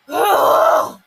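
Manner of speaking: loud, raw groan of frustration; frustrated groan bursting out. Real, not acted. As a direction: let out a fed-up growl. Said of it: "let that out on this review".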